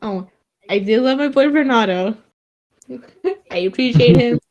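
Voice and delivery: ordinary talk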